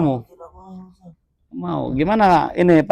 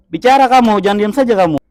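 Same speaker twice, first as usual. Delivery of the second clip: loud, higher commanding yell; angry shout; 6 semitones higher, 5 dB louder than usual. Acted. Ustaz shouting at the silent dukun to talk. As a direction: bark at him to speak.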